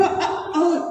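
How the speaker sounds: uh-oh with a sharp catch in the throat between the parts; glottal stop demo. Shown as a demonstration. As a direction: skip over the middle with a throat stop. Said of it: "also hard in a word like uh-oh".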